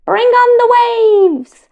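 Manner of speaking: high, slow, loud gleeful call; excited cry. Acted. Mohammed on the water ride calling out, high and thrilled. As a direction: cheer the waves on with delight.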